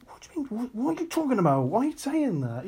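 high, hushed bafflement; quiet, high-pitched mock exasperation; baffled inner reaction.

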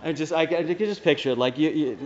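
usual speaking voice

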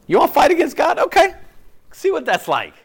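put-on loud, high challenge to a defiant child; stern parent. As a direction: call them out like an exasperated parent.

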